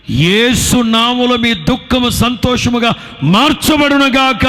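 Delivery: loud, high-pitched fervent proclamation; preaching at full force. Real, not acted. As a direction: declare the blessing over the crowd with full power.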